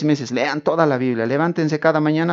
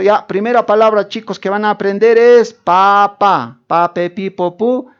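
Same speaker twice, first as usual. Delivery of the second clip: louder, higher teacher addressing a class; schoolteacher; 3 semitones higher, 4 dB louder than usual. Acted. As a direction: lead children through syllables, word by word. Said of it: "La profesora, el profesor".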